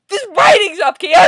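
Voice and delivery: high, loud outburst; furious shouted comeback; enraged retort. Real, not acted. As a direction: yell it back in outrage. Retort to the comic's line.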